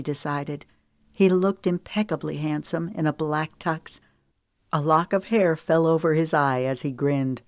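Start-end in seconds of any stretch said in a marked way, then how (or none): none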